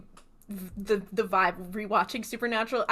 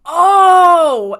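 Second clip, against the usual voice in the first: loud, higher mock-shocked "oh"; exaggerated cry of sudden understanding; dawning realization; 8 semitones higher, 15 dB louder than usual. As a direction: act out a big moment of realization. Predicted listener's sudden realization.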